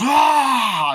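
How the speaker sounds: short, high, loud shouted "Ah!"; mock yell. Reviewer acts out Winston Duke's sudden yell, high and loud. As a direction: bark it out like a startled father.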